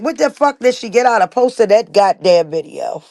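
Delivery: ordinary talk, nothing marked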